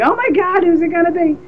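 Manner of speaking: high, eager fan gasp; mock fan excitement; imitating a breathless fan; excited fans. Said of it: "every single fan of the show is going".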